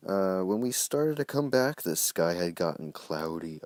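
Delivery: put-on reading his childhood story aloud; story narration. A narrator's reading. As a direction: read it like a dramatic tale.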